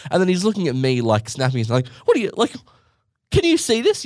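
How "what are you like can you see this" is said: mock frantic dad, pitched up high and louder. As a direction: snap it out like an impatient dad. Dad snapping at cashier.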